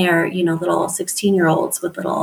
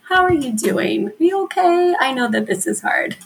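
higher, gently concerned voice to a parent; sympathetic check-in; 5 semitones higher than usual. Retold or imitated. Teacher soothing a worn-out parent, gently concerned and higher. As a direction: ask like you truly care how they're holding up. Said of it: "every time I'm like".

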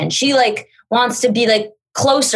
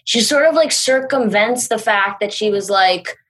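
ordinary talk

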